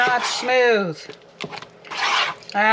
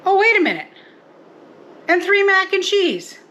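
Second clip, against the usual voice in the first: oh wait, high and louder; sudden realization; 10 semitones higher, 4 dB louder than usual. Real, not acted. Host suddenly remembers the mac and cheese, surprised and high. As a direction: catch the forgotten item with a jolt. Realizes she forgot some meals.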